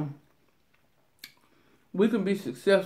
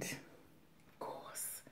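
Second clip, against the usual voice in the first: whispering; 28 dB quieter than usual. Real, not acted. Whispered aside.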